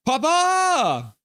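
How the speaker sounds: a high, loud call; excited greeting. Real, not acted. Streamer greeting a chat regular, excited high call. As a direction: call out the name gleefully.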